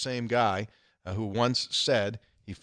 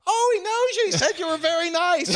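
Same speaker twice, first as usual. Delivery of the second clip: very high, louder delight; high, loud lady gushing over the news; excited lady; 5 dB louder than usual. Retold or imitated. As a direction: gush like the thrilled lady. Lady relays, retold.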